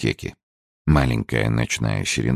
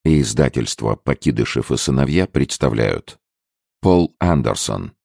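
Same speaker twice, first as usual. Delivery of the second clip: formal audiobook title announcement; announcer read; 2 semitones higher than usual. Acted. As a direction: announce the publishers and author. (дикторское объявление)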